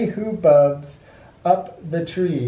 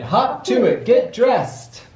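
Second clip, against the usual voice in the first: higher, brisk bird call; bird urging; 7 semitones higher than usual. A narrator's reading. Bird urging the birthday child, higher. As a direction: hurry the child along. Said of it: "Then the bird says".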